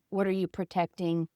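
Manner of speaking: usual speaking voice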